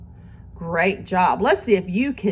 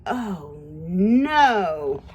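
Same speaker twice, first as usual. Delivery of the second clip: usual speaking voice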